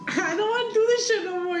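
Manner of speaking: high, mock-tearful whine; retold whining. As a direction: whine it like an exhausted kid. Retold tired complaint to family.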